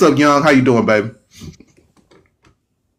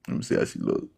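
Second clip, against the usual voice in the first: whispered aside; 13 dB quieter than usual. Real, not acted. Host peeking at a profile.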